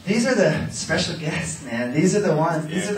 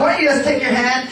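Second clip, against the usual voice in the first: ordinary talk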